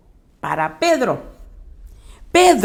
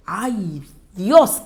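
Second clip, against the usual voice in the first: usual speaking voice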